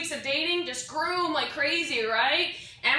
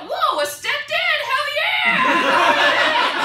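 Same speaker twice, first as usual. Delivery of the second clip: high, loud delighted hell yeah; excited high shout; 14 semitones higher, 8 dB louder, much slower than usual. Retold or imitated. Comic watching porn, high excited shout. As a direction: cheer like you found treasure.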